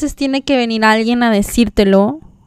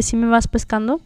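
ordinary talk

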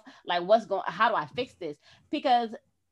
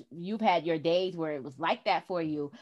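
ordinary talk, nothing marked

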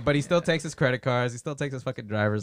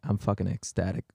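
low, soft, unenthused delivery; deadpan ecstatic; 6 semitones lower, 5 dB quieter than usual. Acted. Guest answers how he feels, dry deadpan. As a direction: claim joy with no lift at all. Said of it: "Oh, you sound ecstatic".

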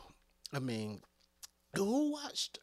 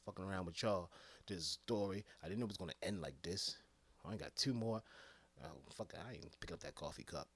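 grumbling crew worker; 7 semitones lower, 6 dB quieter, faster than usual. Acted. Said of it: "what they was like".

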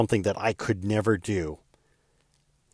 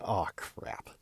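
under-the-breath oh crap, much softer; muttered dismay; 2 semitones lower, 10 dB quieter than usual. Retold or imitated. Thinking to himself in dread, a soft mutter. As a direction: sink inside, barely voiced. Dread on hearing about podcast.

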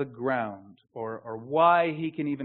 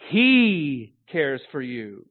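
He long and loud, the rest dropping; slow line with all the weight on He; stress on He; 4 dB louder, much slower than usual. Shown as a demonstration. Preacher marvelling who it is we're talking about, stress on He. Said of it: "emphasizing each of the main words".

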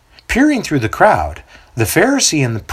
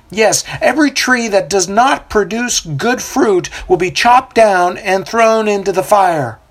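prophet proclaiming; 8 semitones higher than usual. A narrator's reading. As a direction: preach over the river to the crowd. Prophet answers crowd.

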